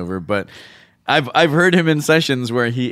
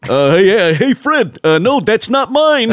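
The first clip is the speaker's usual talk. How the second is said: much higher, louder bumbling voice; flustered bumbling goofy; 8 semitones higher, 5 dB louder, slower than usual. Pretend. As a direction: stammer like a dopey cartoon guy. Playing Barney, cartoon guard scene.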